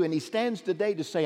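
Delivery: ordinary talk, nothing marked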